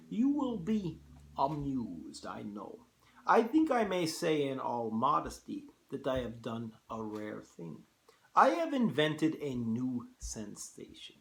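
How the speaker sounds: put-on Russian-accented general, proudly unveiling his idea; courtly host with a Russian accent; General Zaroff. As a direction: attempt a Russian accent, savouring the words. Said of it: "hard to do a Russian accent".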